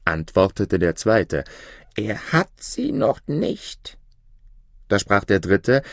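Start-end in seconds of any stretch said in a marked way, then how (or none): 2.0-4.0 much higher, slow bird voice; a doubting little raven; second raven. Acted. Second raven contradicting the first, high and slow.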